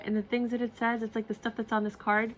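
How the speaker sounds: ordinary delivery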